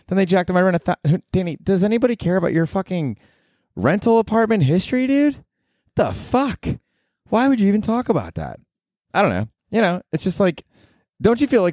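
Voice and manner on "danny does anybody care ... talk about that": high, incredulous scoff at himself; mocking heckler. Host voicing an annoyed listener calling out his rent story, in a high scoff. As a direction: needle him like a bored listener.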